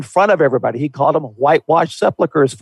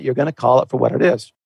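normal talking voice

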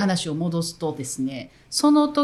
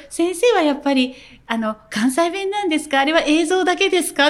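politely puzzled question, lightly voiced; quoting a Tokyo parent; 7 semitones higher than usual. Tokyo parent politely asking the teacher about Kansai dialect. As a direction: ask it like a curious mother. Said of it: "って言われて気づいた".

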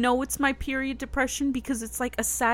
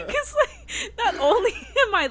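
laughing; 13 semitones higher, 6 dB louder than usual. Real, not acted. Laughing at her own moods.

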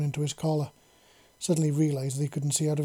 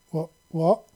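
confused; 2 semitones higher, 6 dB louder than usual. A narrator's reading. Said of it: "she explained in confusion".

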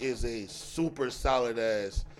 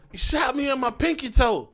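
put-on high, louder child's movie quote; little sister quoting; 10 semitones higher, 5 dB louder than usual. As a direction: parrot it like a six-year-old. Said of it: "go around randomly just saying".

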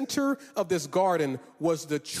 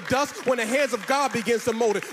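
rising preaching; 5 semitones higher than usual. Acted. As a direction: build it up to a passionate preach. Builds to full preaching force.

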